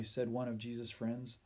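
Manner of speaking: ordinary delivery, nothing marked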